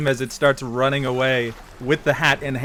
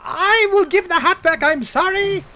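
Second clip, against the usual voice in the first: very high, loud squeaky apology; high-pitched panicked critter voice; squeaky monkey voice; 6 dB louder than usual. Acted. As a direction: plead like a scared little monkey. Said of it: "just goes".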